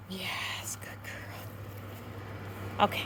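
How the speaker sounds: soft whisper of praise to the dog. Real, not acted. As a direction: hush the praise so she stays put. Praising dog during quiet stay.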